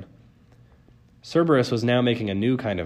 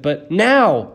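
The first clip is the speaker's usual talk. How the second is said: loud, raised dog-trainer tone; ordering; 7 semitones higher, 9 dB louder than usual. A narrator's reading. Girl cutting off her friend, ordering. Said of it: "she ordered, in the same tone".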